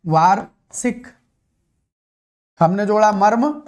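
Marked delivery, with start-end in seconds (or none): 0.1-1.1 very slow, syllable by syllable; teacher stretching वार्षिक for the class; slow spelled-out answer. Shown as a demonstration. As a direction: draw the word वार्षिक out slowly so each sound is clear. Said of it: "शब्द क्या बन जाएगा?".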